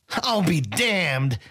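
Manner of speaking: a high, slow, stretched exclamation of delight; cried out. A narrator's reading. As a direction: Sai crowing at an old foe. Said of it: "he cried out".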